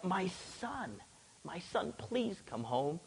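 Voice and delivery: soft, slow imitated plea; pleading. Preacher imitating the official begging Jesus, pleading softly. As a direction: drop the volume and beg slowly.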